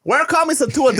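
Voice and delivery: very high mock Chinese American accent. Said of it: "in a Chinese American accent".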